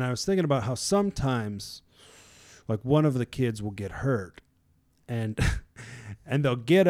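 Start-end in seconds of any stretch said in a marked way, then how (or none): none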